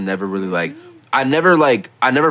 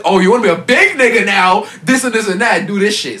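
higher, louder mocking parent voice; 11 semitones higher, 6 dB louder than usual. Parents demanding adult chores, a loud mocking imitation. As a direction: mimic the nagging parent.

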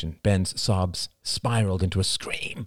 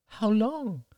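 put-on high child voice; boy asking how long, high child voice; small boy. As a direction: ask like a wary little boy. Child voice asks.

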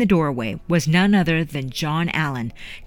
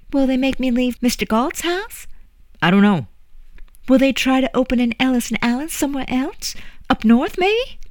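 lightly voiced worried young girl, higher; a child pestering with worried questions; young girl's questions; 9 semitones higher than usual. Young Juliet pestering the old woman with questions, lightly higher girl voice.

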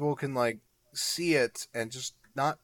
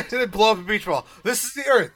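laughing; 7 semitones higher, 5 dB louder, faster than usual. Retold or imitated. Laughing retold beach ball demo.

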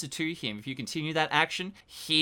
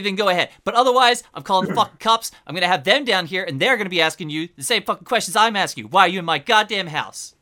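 agitated, raised-voice tirade; outraged apartment owner; 5 semitones higher, 8 dB louder than usual. Acted. Apartment owner threatening intruders with the police, worked up and indignant. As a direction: threaten them with the cops, worked up.

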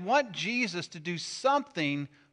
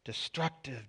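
muttering dad; 7 dB quieter than usual. Retold or imitated. Dad finding a scratch on the car, muttering. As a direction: mutter it under your breath, head ducked. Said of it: "duck his head and go".

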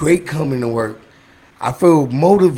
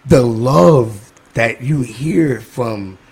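slow, deliberate list; each word given weight; slow heartfelt listing; 4 semitones lower, slower than usual. Real, not acted. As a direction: lay out each crew slowly. Lists crew with slow emphasis.

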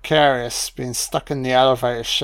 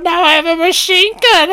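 much higher, louder, mock McClane; hammed-up high squeal; 14 semitones higher, 4 dB louder than usual. Drunk commentator mocking McClane's famous line, high squeal.

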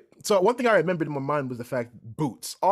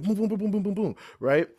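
imitated banging; 6 semitones higher than usual. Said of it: "Wake up to banging".

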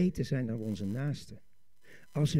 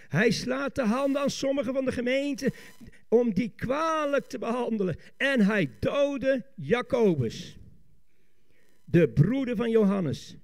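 raised, weighty preacher's declamation; forceful scripture reading; 7 semitones higher, 4 dB louder than usual. Real, not acted. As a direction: proclaim the verse, leaning on the key words. (krachtig declamerend)

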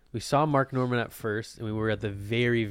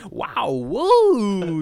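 imitating a comedian; 11 semitones higher, 9 dB louder than usual.

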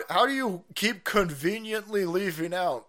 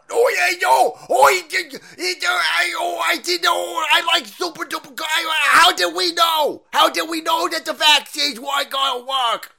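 much higher, loud flustered squealing babble; a panicked official caught out, yelled; mock Fauci; 13 semitones higher, 9 dB louder than usual.

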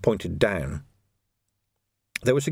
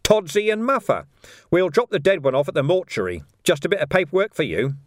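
higher-pitched, mildly projected; lightly raised, businesslike patter; slightly raised voice; 8 semitones higher, 4 dB louder than usual. A narrator's reading. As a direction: announce it casually so others overhear. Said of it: "raising his voice slightly".

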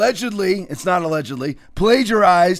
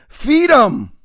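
put-on a small girl's answer, pitched higher; little girl's reply; 5 semitones higher, 5 dB louder than usual. Host quoting King's story, lightly voicing the girl. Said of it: "the little girl looked up straight in the eye and answered".

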